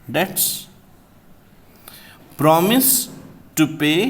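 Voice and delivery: spaced-out dictation of "time barred"; slow dictation. Shown as a demonstration. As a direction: dictate each word for students to write. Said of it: "एक exception क्या है".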